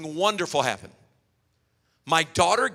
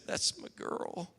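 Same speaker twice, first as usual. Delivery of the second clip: put-on hushed, slow, breathy "that's my girl"; soft fatherly whisper, barely voiced; whispered tenderly; 14 dB quieter, slower than usual. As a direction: whisper it like a proud dad at bedtime. Father answers daughter tenderly.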